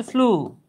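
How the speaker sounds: "ফ্লু" as floo, one syllable; "ফ্লু" modelled with a plain long oo; correct pronunciation. Shown as a demonstration. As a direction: say "ফ্লু" with a pure oo, no glide. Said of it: "এটার উচ্চারণ হচ্ছে".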